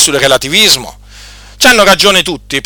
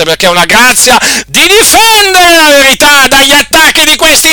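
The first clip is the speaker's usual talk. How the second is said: higher, louder fervent preaching; preaching at full force; 9 semitones higher, 4 dB louder than usual. Acted. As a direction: thunder the thanksgiving from the pulpit. (predica infervorata, più alta e più forte)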